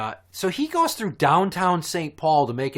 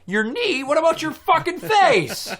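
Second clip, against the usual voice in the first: much higher, louder disbelieving yell; mock exasperated yell; incredulous outburst; 5 dB louder than usual. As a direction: shout it in disbelief at the kid. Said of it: "I'm like".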